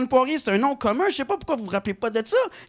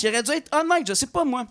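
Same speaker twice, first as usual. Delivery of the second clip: ordinary talk, nothing marked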